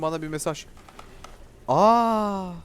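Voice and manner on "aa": startled yelp. Real, not acted. Sudden scare in game.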